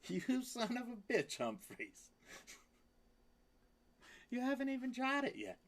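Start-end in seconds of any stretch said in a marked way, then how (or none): 0.1-1.4 high squeaky teasing cry; high mock-insult to a pet; playful pet squeal. Real, not acted. 4.3-5.4 high squeaky voice, a bit fast, for the cat; high sassy pet voice; cat's sassy reply. Pretend. Voicing the cat's retort.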